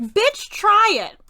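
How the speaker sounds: high, loud, drawn-out plea; stretched-out exasperated yell; exasperated shout. Real, not acted. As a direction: yell it out in exasperation at a stubborn character. Urging character to try it.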